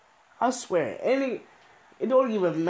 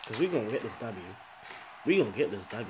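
low determined mutter; 6 semitones lower, 6 dB quieter, faster than usual. Real, not acted. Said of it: "I ain't even getting excited".

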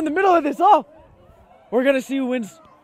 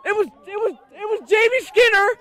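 high loud thrilled yelling; excited screaming; 9 semitones higher, 4 dB louder than usual. Real, not acted. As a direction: scream with disbelief and joy. Wild excitement at interception.

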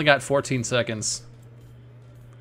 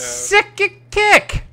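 mock video voice; much slower than usual. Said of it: "acting out the video".

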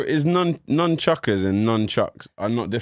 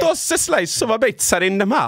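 put-on Italian accent; 9 semitones higher than usual. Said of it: "can you do an Italian accent?".

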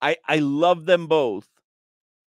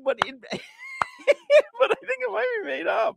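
laughing it out, voice pitched way up; cracking up, then squeaking the words through laughter. Real, not acted. Laughing at made-up story.

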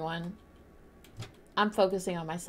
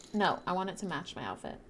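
ordinary talk

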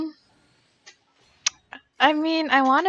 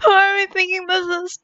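louder, higher, fast nervous question; anxious excited; 7 semitones higher, 9 dB louder, faster than usual. Real, not acted. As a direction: blurt it out in suspense. Same question, tense, louder.

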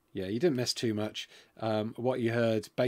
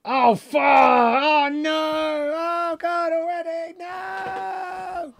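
high, loud dismayed yell; real panicked shout at the game; shocked shout; 9 dB louder, much slower than usual. Real, not acted. Sudden loss in game.

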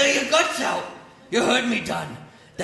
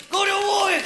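higher, shouted go to work; corner man yelling; 9 semitones higher than usual. Acted. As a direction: yell it from the corner.

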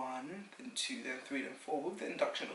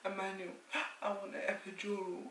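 higher, mildly whimpered request; mock labour plea; 5 semitones higher than usual. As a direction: act out pleading for relief. Retells pleading for relief in labour.

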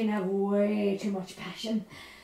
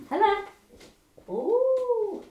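high, surprised phone greeting; excited greeting; 10 semitones higher, much slower than usual. Acted. Speaker on a phone call, excited. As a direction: pitch it up, delighted to hear it.

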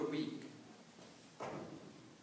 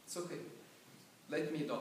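hopeless giving up; 7 dB quieter, much faster than usual. Acted. Starving prisoner gives up.

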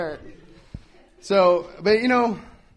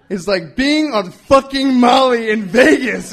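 louder, slower, higher emphatic repeat; 5 semitones higher, 9 dB louder, slower than usual. Shown as a demonstration. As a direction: lean hard into the punchline. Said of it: "emphatic repeat".

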